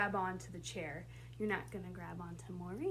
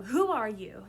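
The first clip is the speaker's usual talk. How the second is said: quoted challenge; 8 dB louder than usual. Shown as a demonstration. Director quotes Cogsworth's line.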